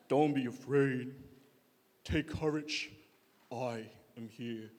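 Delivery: deep, slow lion-king impression; Mufasa. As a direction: drop it very low and draw it out solemnly. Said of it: "he sounds like Mufasa from Lion King".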